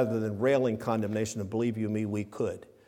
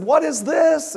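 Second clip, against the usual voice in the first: astonished question; 13 semitones higher, 5 dB louder, slower than usual. Retold or imitated. Crowd at Pentecost asking, astonished.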